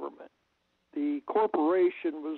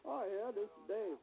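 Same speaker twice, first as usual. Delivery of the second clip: faint, far from the phone; talking away from the mic; phone held away; 4 semitones higher, 12 dB quieter than usual. Real, not acted. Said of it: "speak closer to the phone".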